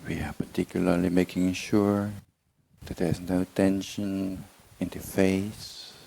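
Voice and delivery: slow, measured meditation guidance with long silences; unhurried body-scan voice, words drawn out; guided meditation. Real, not acted. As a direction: ease them slowly into relaxation, phrase by phrase. Leads a body relaxation meditation.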